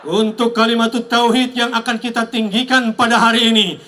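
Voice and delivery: rally speech. Acted. Rally call-and-response to crowd.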